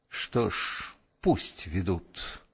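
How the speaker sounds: low, slow resigned thought; bitter pride. A narrator's reading. Lieutenant resigning himself to his fate, bitterly proud. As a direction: think it with quiet bitter pride. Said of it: "с горькой гордостью подумал он".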